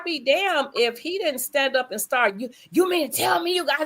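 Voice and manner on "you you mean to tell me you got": high-pitched imitation of an angry rant; mock tirade tumbling into jabber; retold yelling. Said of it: "stood up and started yelling at me".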